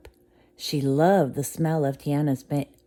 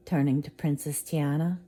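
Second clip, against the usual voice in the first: ordinary delivery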